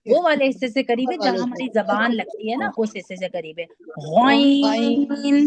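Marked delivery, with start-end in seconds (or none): 4.2-5.5 غین drawn out very slowly and made thick; heavy letter demo. Shown as a demonstration. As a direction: make غین heavy and stretch it out. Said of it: "بہت موٹا کر کے ادا کرتے ہیں".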